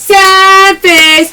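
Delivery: playful loud callout; loud catchphrase call. Real, not acted. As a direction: announce it to the chat. Live sale callout.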